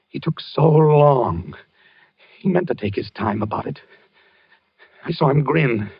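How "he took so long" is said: dragged out. Acted. Reliving the duel.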